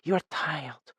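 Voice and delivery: soft, high and tender; murmured. Acted. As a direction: soothe him, very softly. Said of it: "she murmured".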